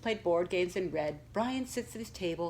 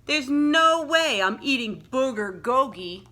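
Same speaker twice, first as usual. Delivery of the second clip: loud, slow, mocking jeer; mocking sneer; 6 semitones higher, 9 dB louder than usual. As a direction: jeer at the new kid. TJ mocks the food.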